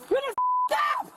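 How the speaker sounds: fast, high yell; shouting. Real, not acted. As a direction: scream it out fast. Woman yelling at the officer.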